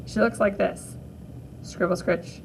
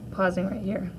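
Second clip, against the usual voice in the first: ordinary delivery, nothing marked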